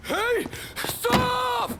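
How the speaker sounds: high, loud shout to stop; frantic shout; panicked yell. Acted. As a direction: yell in alarm at the car. Car bomb panic, yelling.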